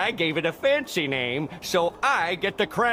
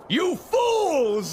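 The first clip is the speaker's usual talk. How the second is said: villain shout; 11 semitones higher than usual. Acted. Plankton to the townsfolk, gloating villain shout. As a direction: spit it out as a triumphant villain. Plankton reveals his evil plan.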